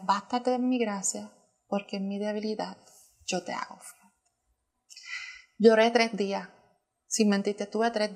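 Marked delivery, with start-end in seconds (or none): 0.0-4.2 soft divine answer, fading away; hushed reassurance. Retold or imitated. The Holy Spirit answering her prayer, retold in a hushed voice. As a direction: let it sink gently toward near silence.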